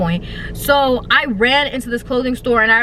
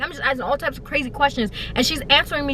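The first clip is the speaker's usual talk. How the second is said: normal talking voice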